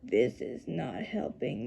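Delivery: low, soft, slow grumble under the breath; quiet muttered complaint. A narrator's reading. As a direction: grumble it to yourself.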